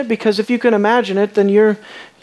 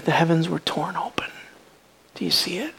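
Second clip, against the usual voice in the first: hushed preacher's whisper; dramatic whisper; 5 semitones lower, 10 dB quieter, faster than usual. Acted. Dramatic hush in sermon.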